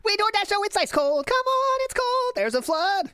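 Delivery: very high squeaky parody voice; squeaky mock voice.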